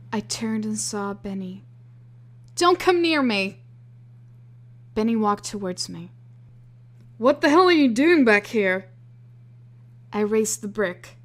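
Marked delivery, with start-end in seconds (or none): 2.6-3.6 high, loud warning; frightened shout. Acted. Threatening approaching friend. 7.2-8.9 high, loud panicked demand; frightened yell. Acted. Yelling at approaching friend.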